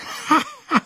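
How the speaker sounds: high short laugh; giggle. Acted. Ron laughing about Neville and Hermine, high giggle. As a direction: break into laughter. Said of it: "fing an zu lachen".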